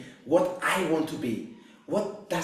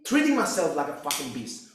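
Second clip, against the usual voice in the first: normal talking voice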